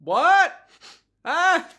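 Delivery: put-on high, loud hard-of-hearing old man. Aged Narantia mishears the drink offer, old man voice. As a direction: squawk like a deaf old man. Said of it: "doing an impression of an old man".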